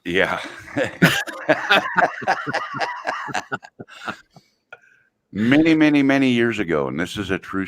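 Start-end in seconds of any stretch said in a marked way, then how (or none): 0.7-3.6 high-pitched drawn-out laugh; real high laughing fit; laughter. Real, not acted. Laughing in reaction to the banter.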